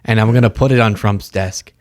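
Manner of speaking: normal talking voice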